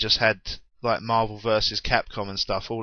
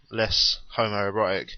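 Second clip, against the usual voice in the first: ordinary delivery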